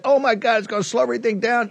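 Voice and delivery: high, fast mock whining; mocking whine. Host mocking officials who stalled, in a high fast whine. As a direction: parody their panicked fretting.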